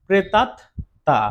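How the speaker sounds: প্রেতাত্মা said slowly with a doubled ত in আত্মা; slow model reading. Shown as a demonstration. Teacher repeating প্রেতাত্মা slowly for the class. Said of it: "উচ্চারণটা কি হবে বললাম".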